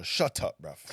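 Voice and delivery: put-on playful, pushy London accent; rude London accent. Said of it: "nobody has manners still".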